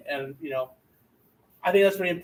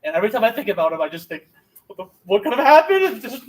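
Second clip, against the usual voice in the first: mock emotional; 7 semitones higher, 5 dB louder than usual. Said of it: "I get emotional".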